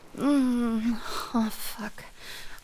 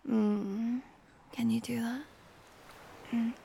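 ordinary talk

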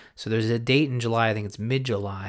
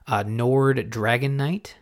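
ordinary talk

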